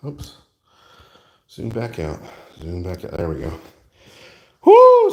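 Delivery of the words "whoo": high, loud whoop; excited whoop. Real, not acted. Builder cheers as the piece takes shape, excited whoop. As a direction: let out a loud, high "whoo".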